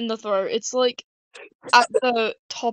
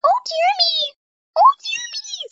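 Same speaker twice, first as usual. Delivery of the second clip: very high, playful squeal; high squeaky voice. Pretend. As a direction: squeak it out, far above your usual pitch. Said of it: "Sounds like I'm saying Jeremy".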